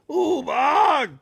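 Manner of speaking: high, loud ghostly moan; hammed-up spooky monster moan. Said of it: "The monsters moaned".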